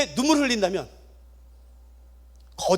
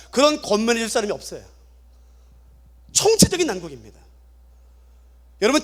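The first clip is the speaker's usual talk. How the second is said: ordinary talk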